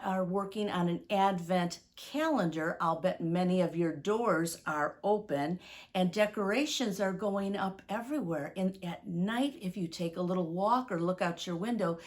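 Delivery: ordinary talk, nothing marked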